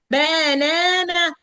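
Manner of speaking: banana drawn out slowly, each A given a full sound; every A fully sounded. Shown as a demonstration. Teacher mocking learners' over-pronounced banana, every A fully sounded. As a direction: stretch every vowel of بنانا, none reduced. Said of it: "ندي بقى كل ايه، لا".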